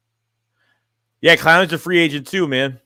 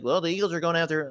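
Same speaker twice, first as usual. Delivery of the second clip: soft, mock newscaster delivery; lightly imitating a TV sports reporter; 3 semitones higher, 7 dB quieter than usual. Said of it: "The reporter voice".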